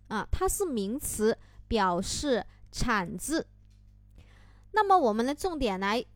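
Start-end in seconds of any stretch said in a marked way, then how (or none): none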